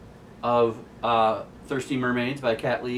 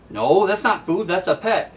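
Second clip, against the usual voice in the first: higher, louder protest; indignant correction; 8 semitones higher, 6 dB louder than usual. Retold or imitated. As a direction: set her straight, loudly. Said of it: "the mermaid looks and says".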